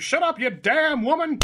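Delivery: hammed-up chauvinist rogue; gruff mock rogue. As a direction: snap it like an old-movie cad. Voicing Dag answering.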